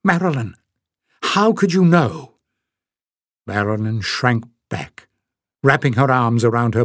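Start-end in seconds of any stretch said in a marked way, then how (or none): 0.0-2.4 blurted out higher and urgent; blurted question. Acted. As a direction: burst out with the question. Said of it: "She managed to blurt out one".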